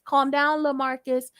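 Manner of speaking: ordinary talk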